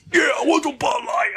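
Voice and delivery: hammed-up wrestler yell; Stone Cold shouting. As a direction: demand the beer at full volume. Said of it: "he says Bud Light".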